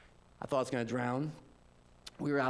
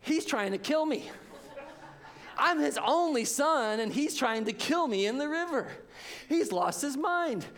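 put-on panicked child; 8 semitones higher than usual. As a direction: play the terrified kid, hammed-up. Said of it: "And then I go".

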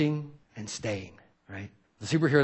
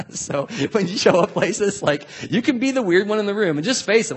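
laughing encouragement; 7 semitones higher, 5 dB louder than usual. Real, not acted.

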